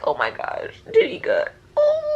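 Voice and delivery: hushed, then high tearful whimper; near tears. Retold or imitated. Reader reliving a scene that nearly made her cry, tearful.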